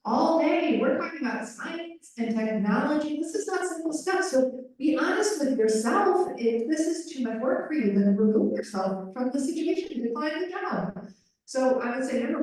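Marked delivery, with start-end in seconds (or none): 0.0-11.1 higher, exasperated scolding of a sleepy interpreter; retold stern lecture. Interpreter retelling how she scolded a sleepy colleague, higher exasperated lecture. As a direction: mimic yourself lecturing someone who slacks. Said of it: "But I was like".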